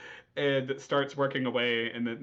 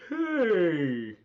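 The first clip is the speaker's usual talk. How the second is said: high loud protest; startled indignant cry; indignant yelp; 7 semitones higher, 6 dB louder than usual. Acted. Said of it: "He goes".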